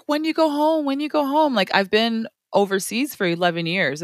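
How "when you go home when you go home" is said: high, repetitive mimicked questioning; nagging everybody. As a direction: imitate people pestering you. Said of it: "everybody's always like".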